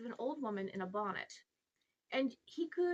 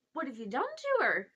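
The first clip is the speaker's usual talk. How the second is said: alarmed demand; 4 semitones higher, 7 dB louder than usual. Acted. Boy demands to know about Polly.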